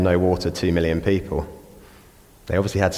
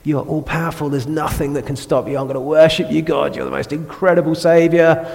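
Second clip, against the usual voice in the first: starting in a hushed breath, then rising into showy praise; gushing praise; 5 semitones higher than usual. Retold or imitated. Preacher mimics his own gushing worship to God, in hammed-up praise. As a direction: overdo the worship, half mocking yourself. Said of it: "it's like".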